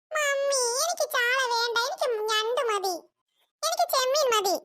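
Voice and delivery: put-on high, slow child voice demanding; whiny little kid. Child refusing sardines.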